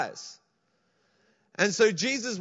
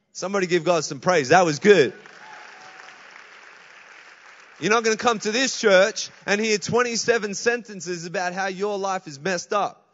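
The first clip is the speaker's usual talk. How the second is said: louder, fired-up pulpit shout; full-force preaching; 9 dB louder than usual. Acted. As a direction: rouse the congregation.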